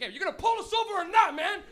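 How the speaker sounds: loud, high challenge to the cops; exasperated yell. Retold or imitated. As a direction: yell at the car behind, fed up. Nervous driver yells at following cops.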